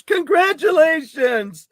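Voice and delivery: high, slow, gushing elf cheer; christmas elf. Retold or imitated. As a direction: beam it out like a giddy elf. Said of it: "Sounds like Elf when he goes".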